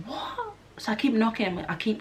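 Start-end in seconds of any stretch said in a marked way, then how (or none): 0.1-0.5 high, soft disbelieving what; a sharp high what of shock; startled what. Retold or imitated. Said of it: "And I'm like".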